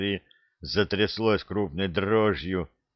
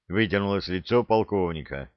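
ordinary delivery

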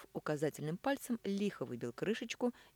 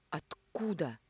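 breathless, voiceless Откуда with falling end; stunned whisper; much slower than usual. Acted.

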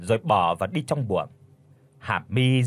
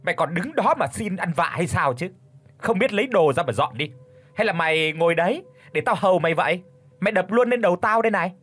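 furious yelling; 6 semitones higher, faster than usual. Acted. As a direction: bark orders in a rage. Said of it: "đang nổi điên lên mà quát". (mẹ chồng nổi điên quát)

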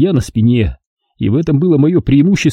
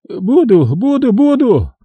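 frantic eagerness; 12 semitones higher, slower than usual. A narrator's reading. Captive eagerly agrees.

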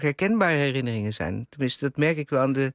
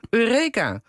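triumphant cry; 6 semitones higher, 4 dB louder than usual. A narrator's reading.

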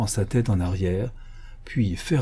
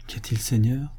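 ordinary delivery, nothing marked

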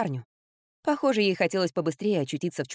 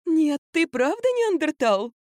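admiring amazement; 10 semitones higher, slower than usual. A narrator's reading. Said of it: "восхитилась Катя".

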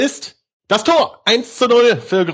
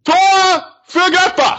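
goal call repeated; 11 semitones higher than usual. Real, not acted.

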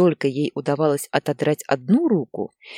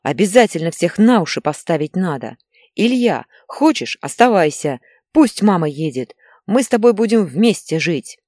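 loud, irritated father; shouting; 3 semitones higher, 7 dB louder than usual. A narrator's reading. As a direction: carry the row on in a raised voice. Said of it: "кричал он Инне". (раздражённо, на повышенных тонах)

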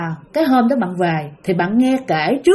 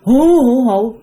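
mock ghost wail; 5 dB louder, much slower than usual. Imitating ghost crying.

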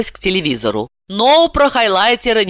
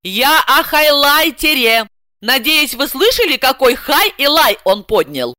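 indignant; 7 semitones higher, 3 dB louder than usual. A narrator's reading. Heroine snaps back at translator.

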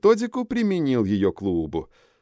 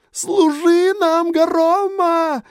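very high, slow, drawn-out shriek; piercing scream; 4 dB louder, much slower than usual. A narrator's reading. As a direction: scream it out in ecstatic frenzy. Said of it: "пронзительно вопил он".

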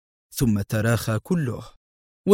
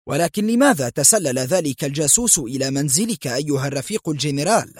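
ordinary delivery, nothing marked

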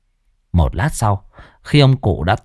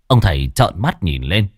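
ordinary delivery

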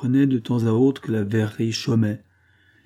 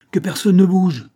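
higher, louder threatening order; menacing shout; 8 semitones higher, 7 dB louder than usual. A narrator's reading. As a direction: freeze everyone with a threat. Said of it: "cria Joël d'une voix menaçante".

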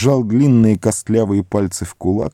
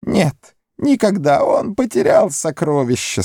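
high moaning creature; moaning Gollum; 9 semitones higher than usual. A narrator's reading. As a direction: moan out the loss. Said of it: "стонал Горлум".

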